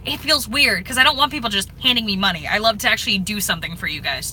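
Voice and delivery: normal talking voice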